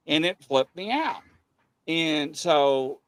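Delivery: ordinary delivery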